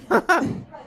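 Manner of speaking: short high laugh; bright laugh. Real, not acted. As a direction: laugh at your own joke. Laughs at own joke.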